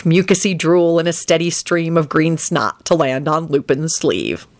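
ordinary delivery, nothing marked

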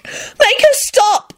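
high, drawn-out plea; high-pitched girlish desperation; desperate squeal. A narrator's reading. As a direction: beg in a squeal. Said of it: "Rosie squealed and gave Jamie a desperate look".

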